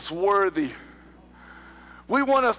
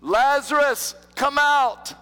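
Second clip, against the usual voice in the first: calling out loudly to the tomb; loud shout; 7 semitones higher, 4 dB louder, slower than usual. Acted. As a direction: shout the command, high and loud. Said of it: "He shouted with a loud voice".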